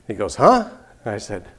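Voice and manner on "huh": puzzled huh. Retold or imitated. Biker didn't hear her.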